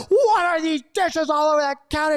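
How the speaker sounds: high-pitched, exaggerated blow-up; acted angry outburst. Coming home to husband's dirty dishes, exploding in mock fury. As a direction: explode in mock outrage, voice jumping up.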